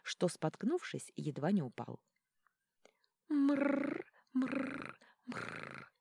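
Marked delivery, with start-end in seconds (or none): 3.3-5.9 rolled purring "mrrr", fading away; cat purr. A narrator's reading. Relaxed felines rumbling as a refrain, purring. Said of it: "мягкий рокот расслабившихся кошачьих".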